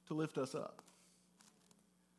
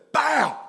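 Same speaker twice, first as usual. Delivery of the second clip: imitating a loud pop; sudden loud bang noise; mock loud pop; 6 semitones higher, 9 dB louder than usual. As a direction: shout the pop sound. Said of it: "really loud".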